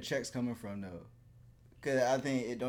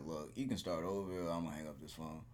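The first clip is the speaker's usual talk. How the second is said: firm and a little softer, a stern phone warning; 7 semitones lower, 8 dB quieter than usual. Retold or imitated. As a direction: lay down the law, quietly and firmly. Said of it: "I just give it a stern".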